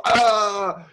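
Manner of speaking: loud, high mock-angry "aah"; angry outburst. Shown as a demonstration. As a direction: blow up with a triggered yell. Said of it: "something makes you angry".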